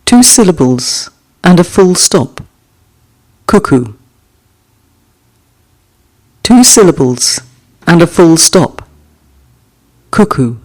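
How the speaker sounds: gentle recital of a short poem; poem reading. Acted. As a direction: let each image settle before the next. Reads haiku aloud twice.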